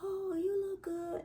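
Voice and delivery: high, soft, drawn-out mock admiration; slow falsetto cooing compliment; imitating admirers. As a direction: coo it slowly, high and hushed. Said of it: "They be like".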